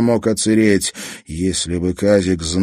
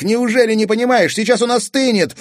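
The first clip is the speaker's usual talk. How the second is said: put-on high, loud anxious boy; urgent alarm; 4 dB louder than usual. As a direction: plead in a panic. Kazik pleading urgently.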